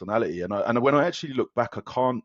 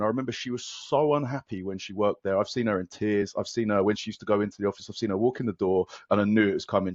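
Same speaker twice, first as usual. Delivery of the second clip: usual speaking voice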